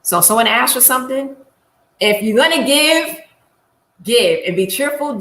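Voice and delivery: ordinary delivery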